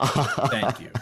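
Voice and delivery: thanks through a laugh. Real, not acted. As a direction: laugh while saying it. Laughing after the teasing.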